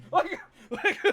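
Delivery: real laughter breaking out high; high laughing fit. Real, not acted. Telling friends the story, cracking up laughing. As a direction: laugh helplessly. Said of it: "laughing fit".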